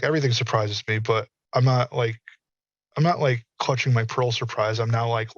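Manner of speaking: normal talking voice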